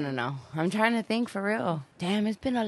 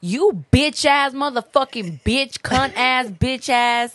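mock cussing out; 5 semitones higher, 6 dB louder than usual. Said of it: "Cuss out the camera".

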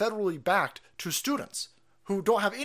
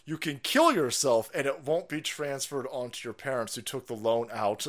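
ordinary delivery

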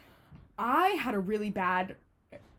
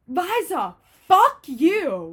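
loud, higher mock scream; retold scream; 6 semitones higher, 9 dB louder, much slower than usual. Drunk friend screaming down the hallway at Liza, retold yell. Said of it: "just screams down the hallway".

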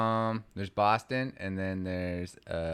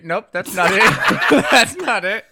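ordinary talk, nothing marked

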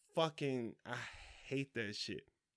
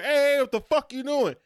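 much higher, louder yell at the guy; confrontational yell; 10 semitones higher, 8 dB louder than usual. Retold or imitated. Husband yelling at man twirling his wife. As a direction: call him out across the mall.

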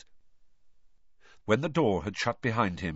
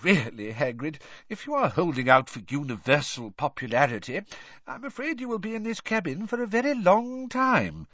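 stern headmaster, pitched higher; 8 semitones higher than usual. A narrator's reading. Headmaster scolding his gamekeeper for self-pity, stern. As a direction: chide with mild exasperation. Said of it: "now peering sternly over".

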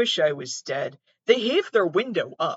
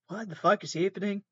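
ordinary talk, nothing marked

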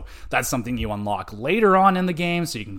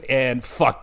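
ordinary talk, nothing marked

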